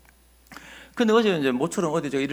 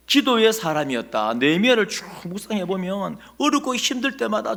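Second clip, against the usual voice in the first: loud, emphatic sermon delivery, raised pitch; full-force preaching; 3 semitones higher, 5 dB louder than usual. Acted. Pastor urging the congregation to remember Nehemiah, preaching hard. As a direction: drive the message home to the congregation.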